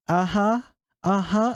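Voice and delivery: put-on high, innocent kid voice; imitated little brother. Young brother confirming to mom, imitated innocence. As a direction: chirp it upward like a small child.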